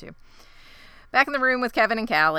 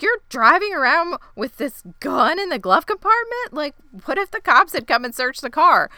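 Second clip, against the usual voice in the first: exasperated scolding; 7 semitones higher, 6 dB louder than usual. Retold or imitated. Jeff scolding about gun.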